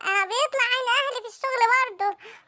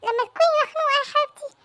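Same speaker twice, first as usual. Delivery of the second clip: high coaxing; 6 semitones higher than usual. Acted. Husband soothing upset wife.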